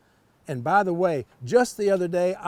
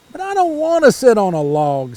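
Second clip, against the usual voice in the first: frog protesting; 3 semitones higher, 8 dB louder than usual. A narrator's reading. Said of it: "said the frog".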